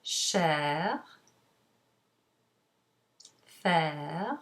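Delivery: "chair, faire, fier, mère, vers" one by one, each ending in open eh plus light R; French pronunciation demo. Shown as a demonstration. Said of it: "find it in words such as".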